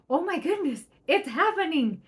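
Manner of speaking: high, loud, astonished joy; amazed delight. Retold or imitated. Parent amazed the family finally comes, high and loud delight. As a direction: gasp it out in delighted disbelief. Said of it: "And you're like".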